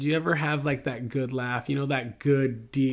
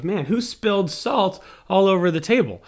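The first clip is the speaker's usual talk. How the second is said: daughter's exclamation; 6 semitones higher, 4 dB louder, faster than usual. Retold or imitated. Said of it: "And she goes".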